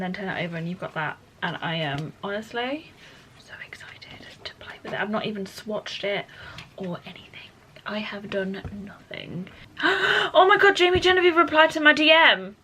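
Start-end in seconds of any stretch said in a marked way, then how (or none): 3.4-9.5 hushed excited whisper; breathless whispered admission. Real, not acted. Gushing quietly over new palette. 9.8-10.3 high sudden gasp of surprise; startled intake of breath; sharp gasp. Real, not acted. Sees celebrity replied to her.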